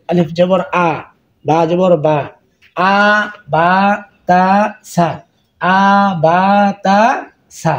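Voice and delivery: আলিফ জবর আ spelled out clearly, letter, vowel sign, then sound; spelling drill. Shown as a demonstration. Teacher spelling Arabic letters for students, clear drill. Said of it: "এই চারটা হরফ এইভাবে শিখবেন".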